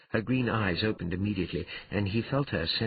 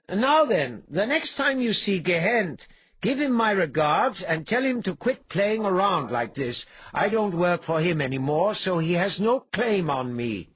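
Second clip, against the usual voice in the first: high, raised voice giving orders; taunting hero; 11 semitones higher, 5 dB louder than usual. Acted. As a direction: call instructions up to the helpless brute. Hero lecturing suspended brute.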